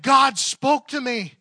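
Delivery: loud, high, drawn-out amazement; amazed outburst. Retold or imitated. Woman telling her counselor of a revelation, amazed outburst. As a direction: imitate an overwhelmed cry of discovery. Acting out woman's breakthrough moment.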